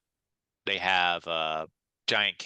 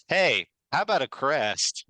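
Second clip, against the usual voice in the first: slow, higher, louder hammed-up sales line; cheesy ad pitch; 6 semitones higher, 4 dB louder, slower than usual. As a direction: sell the toothpaste with a fake grin. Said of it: "He's like".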